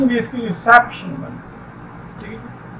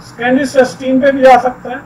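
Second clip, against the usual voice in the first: usual speaking voice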